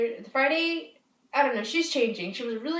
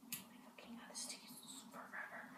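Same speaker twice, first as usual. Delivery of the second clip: very soft, slow whisper to oneself; impatient whispered aside; 26 dB quieter than usual. Real, not acted. To oneself while waiting for the phone, whispered grumble. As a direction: whisper a complaint under your breath.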